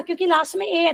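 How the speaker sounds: usual speaking voice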